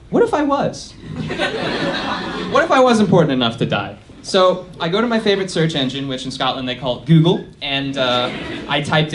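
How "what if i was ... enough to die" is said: dramatic realization. Acted. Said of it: "the thought that goes through".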